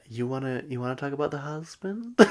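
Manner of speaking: put-on weird accent, lightly mocking; Meredith's weird accent. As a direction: mimic an odd accent, quietly rising at the end. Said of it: "she says it in this weird accent".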